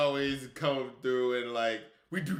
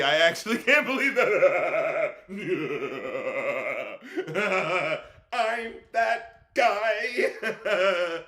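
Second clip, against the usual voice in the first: laughing; much slower than usual. Real, not acted. Laughing at his own claim.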